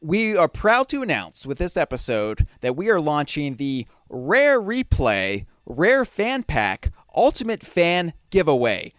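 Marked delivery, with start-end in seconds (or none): 2.9-8.9 announcer voice. Acted. Announcing contest name slowly.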